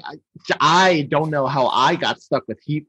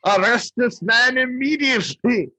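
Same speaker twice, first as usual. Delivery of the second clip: high, hammed-up tyrant's order; pompous command pitched way up; Burgermeister; 9 semitones higher than usual. Burgermeister ordering an immediate arrest, high over-the-top voice. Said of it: "how over the top the Burgermeister is".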